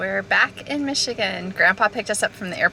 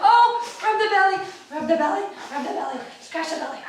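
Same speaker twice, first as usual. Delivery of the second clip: cooing fading; 6 semitones higher, faster than usual. Real, not acted.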